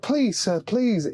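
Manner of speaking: mock beseeching appeal; imitated pleading. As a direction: plead lightly as a man asking a favour. Retold begging by homeless man.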